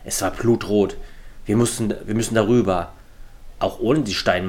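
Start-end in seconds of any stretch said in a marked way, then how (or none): none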